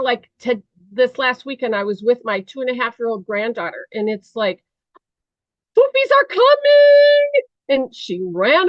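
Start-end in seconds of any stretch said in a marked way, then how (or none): 5.8-7.4 put-on high, loud squeal of a little girl; excited toddler. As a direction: shout it like a thrilled two-year-old. Toddler granddaughter announces bathroom trip.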